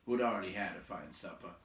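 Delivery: usual speaking voice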